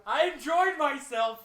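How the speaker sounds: high, loud, drawn-out protest; mock-offended outcry; playful indignation. Real, not acted. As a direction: defend yourself loudly, stretching it out. Defends self against teasing.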